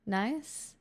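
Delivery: ordinary delivery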